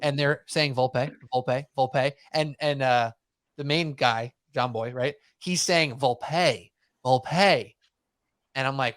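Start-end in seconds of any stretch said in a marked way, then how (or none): 0.8-2.1 Volpe repeated as vol-pay; each Volpe ends on a clear "pay"; Volpe ending in PAY. Retold or imitated. Host quoting podcasters to co-hosts, Volpe said vol-pay. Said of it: "I'm emphasizing the pay". 6.0-7.8 slow Volpe, leaning on "pay"; emphasized PAY in Volpe. Retold or imitated. Host quoting John Boy, Volpe slowly said with stressed PAY. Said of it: "I'm emphasizing the pay".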